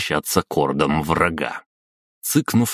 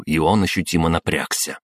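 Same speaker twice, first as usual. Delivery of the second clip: usual speaking voice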